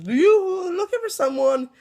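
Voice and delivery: mocking little puppet sneer; creepy dummy. Evil dummy surprising the kids searching for their brother, high taunting voice.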